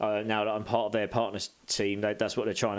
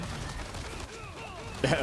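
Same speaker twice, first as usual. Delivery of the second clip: breathy laugh; 6 semitones higher, 5 dB quieter than usual. Real, not acted. Laughs at chat request.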